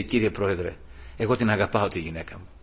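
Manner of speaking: low, soft retold plea; understated tearful plea. Accused husband pleads to the judge, understated tearful plea. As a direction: quietly imitate a man near tears. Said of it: "Άρχισε να κλαίει".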